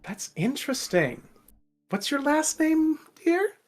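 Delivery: soft then sliding very high, condescending; catty noble girl. Acted. Fan girl quizzing Ina, soft then high and sneering. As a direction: purr it sweetly, then pitch up on dear. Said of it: "just goes".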